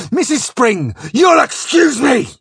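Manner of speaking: high, loud, explosive parting line; furious exit shout. A narrator's reading. Captain excusing himself to his wife mid-rage, furious shout. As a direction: bark it out before storming off. Enraged captain storms out past Flashman.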